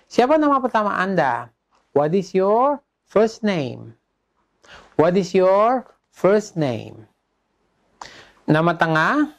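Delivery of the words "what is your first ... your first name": slow question split into two chunks; slow drill delivery, pausing midway; repeat-after-me model. Shown as a demonstration. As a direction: model the question slowly for learners. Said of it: "Anda ulang sesudah saya".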